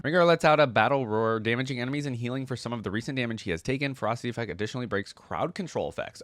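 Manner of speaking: usual speaking voice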